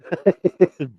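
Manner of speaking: genuine high burst of laughter, falling away; real laugh. Real, not acted. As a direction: laugh out loud at your own joke. Laughs alone after joke.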